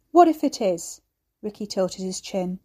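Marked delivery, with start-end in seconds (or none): 0.1-1.0 loud, much higher defiant challenge. A narrator's reading. Ricky defiantly challenges the old man. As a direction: throw the question back at him.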